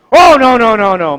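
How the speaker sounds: loud, high string of protests, acted out; emphatic dismissal. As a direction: wave it away in a rush. Grandpa dismisses boy's guess.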